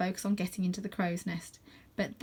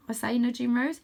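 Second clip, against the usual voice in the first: ordinary delivery, nothing marked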